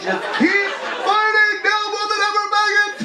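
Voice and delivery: hammed-up rallying cry to the gays; mock ally cheer.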